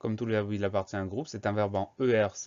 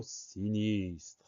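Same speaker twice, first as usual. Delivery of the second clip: sinistre drawn out very slowly; slow dictation; 2 semitones lower, 6 dB quieter than usual. Shown as a demonstration. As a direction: stretch the sounds of sinistre for learners. Teacher presents word to spell.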